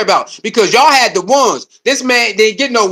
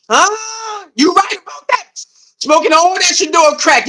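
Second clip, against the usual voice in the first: high, hammed-up junkie voice; mock crackhead; 6 semitones higher, slower than usual. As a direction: play the hooked fan, over the top.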